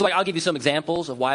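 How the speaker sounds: ordinary talk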